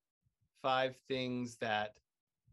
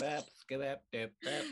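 lightly voiced alien scat. Host riffing on space nonsense, lightly done alien voice. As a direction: chatter in nonsense syllables like a little alien. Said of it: "alien voice".

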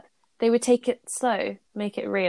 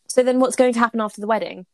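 louder, sharp question; snapped; 2 semitones higher, 6 dB louder than usual. A narrator's reading. Draco snapping at Hermione about the wedding, sharp and loud. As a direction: bite the words off at her. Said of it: "he snapped".

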